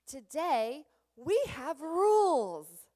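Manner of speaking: playful teacher reveal; mock exciting reveal. Shown as a demonstration. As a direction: sell the rules like a treat. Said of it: "created anticipation and curiosity".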